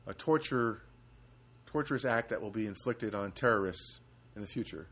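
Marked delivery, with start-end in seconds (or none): none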